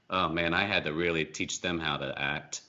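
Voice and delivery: ordinary talk, nothing marked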